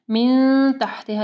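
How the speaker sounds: मिन with the n held as a hum; slow मिन gliding into तहतिहा; ikhfa ghunnah. Shown as a demonstration. Teacher isolates the rule for students, held ghunnah. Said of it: "यहाँ पे भी इख्फा गुन्ना होगा".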